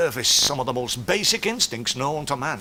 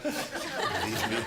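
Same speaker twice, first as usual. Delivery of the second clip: put-on German accent; 7 semitones higher than usual. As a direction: play a pompous German doctor. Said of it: "psychiatrist Doctor Heinz von Meatball".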